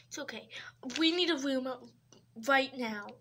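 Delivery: ordinary delivery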